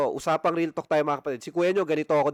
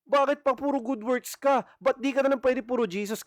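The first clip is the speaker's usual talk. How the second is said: preacher mimicking a smug believer, mocking and high; mocking boastful believer; 9 semitones higher than usual. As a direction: sneer it out like a know-it-all believer. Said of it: "At magyayabang pa".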